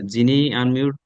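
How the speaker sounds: usual speaking voice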